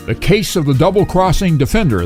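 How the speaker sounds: ordinary delivery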